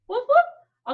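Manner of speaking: cute puppy woof. Acted. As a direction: bark up high and playful. Dog noise higher.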